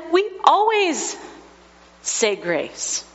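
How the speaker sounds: put-on high small-boy voice that drops at the end; a toddler stating the family rule; high child voice, falling off. Toddler quoted at table.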